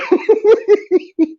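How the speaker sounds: real high cackle; high helpless laugh; high burst of laughter. Real, not acted. Laughs at memory.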